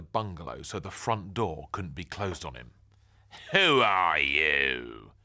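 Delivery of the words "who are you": low, slow, loud nasal bark; barked nasally. Acted. Nosy neighbour barking at the boy, low, slow and nasal. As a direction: bark it down your nose. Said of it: "he barked nasally".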